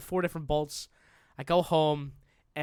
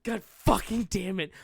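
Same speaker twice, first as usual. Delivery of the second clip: higher, drawn-out mock curse; slow it down, leaning on the swearing; frustrated; 4 semitones higher than usual. Caller cursing over missed dinner, frustrated.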